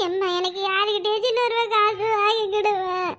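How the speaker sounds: slow, drawn-out mock sobbing; fake crying. Son begging mother for money, fake crying. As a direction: beg through put-on wails. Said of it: "அழுவுற மாதிரி நடிச்சாதே எங்க அம்மா நம்புவாவ".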